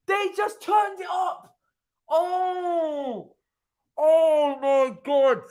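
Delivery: high, drawn-out amazed cries; stunned disbelief. Real, not acted. Fan watching a goal go in, high stunned disbelief. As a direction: stretch the words out high in awe.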